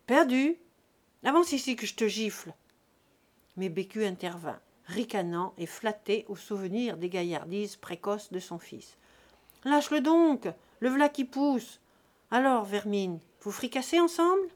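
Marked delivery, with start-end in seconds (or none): 0.1-2.5 louder, higher, fast scolding; angry mother. A narrator's reading. Mother scolding her son over his lost cap, angry. 9.7-14.5 put-on loud, jovial drunken host; boisterous drunk. A narrator's reading. Drunk Jésus-Christ ordering a feast from the landlady, booming. Drunk father teasing kids.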